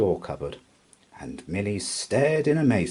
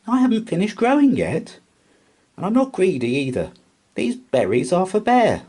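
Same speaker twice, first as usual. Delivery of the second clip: indignant mouse; 11 semitones higher, 5 dB louder than usual. A narrator's reading. Milly keeps arguing.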